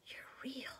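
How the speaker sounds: high, breathless whisper; awed whisper. Acted. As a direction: breathe it in wonder. Girls discover Aslan alive.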